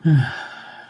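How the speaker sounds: heavy breathy sigh; audible sigh. Real, not acted. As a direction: let out one big sigh. Exasperated with the panting dog.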